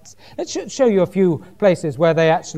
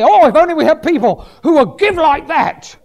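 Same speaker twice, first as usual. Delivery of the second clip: high, loud mock pleading; emotional pressure; 8 semitones higher, 5 dB louder than usual. Mimicking preachers pressing people to give, emotional and hammed-up. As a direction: imitate a preacher laying on the guilt. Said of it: "it's sort of extra emotional pressure to give".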